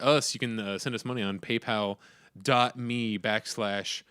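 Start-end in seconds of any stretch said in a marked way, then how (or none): 2.4-4.0 slow. Shown as a demonstration. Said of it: "So I always say backslash".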